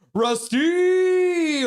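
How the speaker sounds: high, loud, drawn-out name call; excited shout-out. Acted. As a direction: holler the name like a fan's greeting. Reads a chat shout-out aloud.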